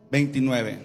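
ordinary delivery

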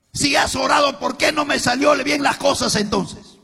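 high, loud accusation; furious shout at his wife; angry question. Retold or imitated. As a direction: demand it from her.

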